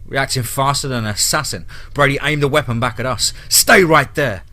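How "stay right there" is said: loud, raised-pitch command; yelled order. A narrator's reading. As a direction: yell it to halt them. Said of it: "he yelled".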